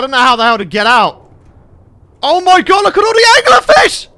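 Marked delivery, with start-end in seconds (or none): 2.2-4.1 high, loud, rising panic; alarmed squeal. Real, not acted. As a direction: voice climbs into a frightened shriek. Alarmed at swarm of anglerfish.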